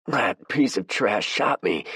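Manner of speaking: slow, pained, wounded words; gasping in pain. Acted. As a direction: force it out through the pain. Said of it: "he gasped".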